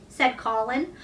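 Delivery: usual speaking voice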